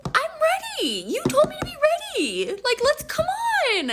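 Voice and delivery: very high, loud whiny complaint; exasperated protest. Retold or imitated. Dressed-up woman to boyfriend who overslept, exasperated.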